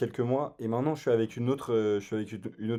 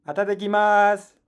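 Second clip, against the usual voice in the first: anime voice; 9 semitones higher, 9 dB louder than usual. Pretend. Mock Japanese anime greeting.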